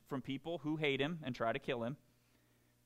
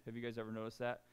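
ordinary talk

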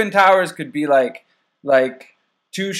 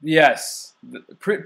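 ordinary talk

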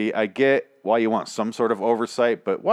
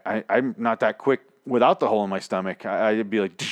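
ordinary talk

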